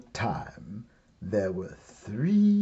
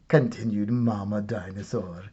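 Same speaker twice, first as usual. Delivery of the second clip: ordinary delivery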